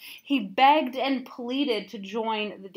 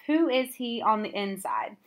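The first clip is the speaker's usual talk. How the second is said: usual speaking voice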